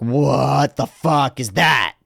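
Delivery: hammed-up shock. Tripping person seeing own face in mirror, hammed-up shock. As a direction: stretch it out in exaggerated disbelief.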